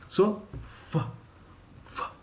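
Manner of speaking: "f" hissed through teeth, no voice at all; unvoiced f. Shown as a demonstration. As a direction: breathe out "f" with no voice. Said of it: "F was unvoiced".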